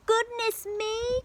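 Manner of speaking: high, slow startled cry; alarmed giantess. Acted. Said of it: "cried the giantess".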